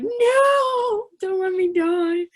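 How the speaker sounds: high, loud, drawn-out mock wail; hammed-up begging cry; desperate plea. Trump begs for his life.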